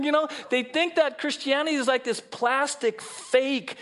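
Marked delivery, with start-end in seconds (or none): none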